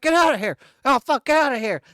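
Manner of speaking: high, loud retold yelling at an attacking deer; hammed-up shriek to shoo it off; mock panicked yell. Driver yelling at the deer beating him, a mock panicked high yell.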